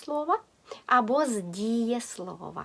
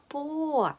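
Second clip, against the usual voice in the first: "по" higher and drawn out as the stressed syllable; 5 semitones higher, 3 dB quieter than usual. Shown as a demonstration. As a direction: lift and lengthen "по". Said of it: "Наголос на". (наголос на «по», вище й протяжно)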